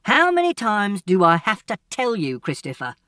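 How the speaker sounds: loud, high scolding father; a father at the end of his patience, raising his voice; exasperated father scolding his son loudly. Acted.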